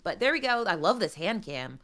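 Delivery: usual speaking voice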